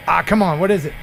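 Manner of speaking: ordinary talk, nothing marked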